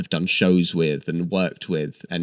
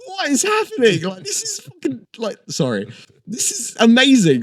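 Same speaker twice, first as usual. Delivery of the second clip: shrieking high in disbelief; stunned, high-pitched amazement; amazed yelling; 5 dB louder than usual. Retold or imitated. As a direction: squeal it like you can't believe your eyes. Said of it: "and everyone was like".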